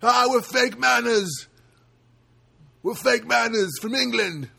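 loud, high mock English punk frontman; drawn-out hammed-up English punk shout; punk band. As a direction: yell it slowly like a band introducing itself. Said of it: "it sounds like a punk band".

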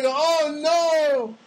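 high hammed-up "oh no"; mock dismay. Hearing he looks chunky, mock dismay. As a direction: act shocked at the news. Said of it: "I go".

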